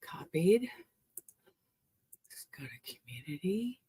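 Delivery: soft whispered muttering; whispered fiddling with the phone. Real, not acted. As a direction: murmur the steps under your breath. Whispered setup of the stream.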